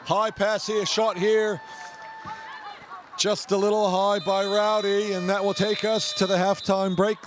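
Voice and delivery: sports commentator calling the game; live play-by-play. Acted. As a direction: call the action as it happens. Live canoe polo commentary.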